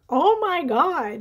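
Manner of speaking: high, loud, drawn-out astonishment; stunned amazement. Retold or imitated. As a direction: imitate a husband's slow-dawning shock. Husband's stunned reaction, retold.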